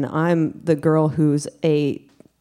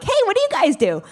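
very high, chirpy butting-in; high-pitched mock-friendly opener; over-eager greeting; faster than usual. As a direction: pipe up much too eagerly. Butting into strangers' conversation.

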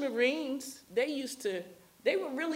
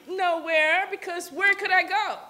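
higher and louder, fed-up retort; exasperated teen; 8 semitones higher, 8 dB louder than usual. Retold or imitated. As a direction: throw it back at him, nowhere to go. Teen answering father, exasperated.

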